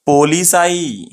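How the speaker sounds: "पोलिसाई" drawn out slowly, syllable by syllable; mistaken pronunciation. Shown as a demonstration. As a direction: stretch "पोलिसाई" to end on a long "saa-ee". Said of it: "ये wrong word हो जाता". ("पोलिसाई" को धीरे, खींचकर बोलें)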